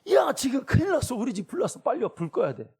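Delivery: put-on mother calling the kids home in panic; urgent alarm. Shown as a demonstration. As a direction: shout it like the house is really on fire. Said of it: "이렇게 들어야 되겠어요?".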